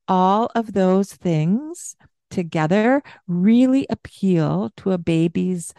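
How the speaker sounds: ordinary delivery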